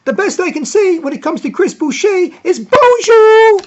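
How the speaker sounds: very high, loud broadcaster impression; Jack Armstrong. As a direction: call it out excitedly, shouting the bonjours. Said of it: "and he'll say".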